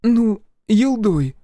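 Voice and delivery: высокий, медленный, сдавленный голос матери; выдави слово через стыд; стыдливое признание. A narrator's reading. Mother forced to say rude word. (мать медленно, стыдливо выговаривает)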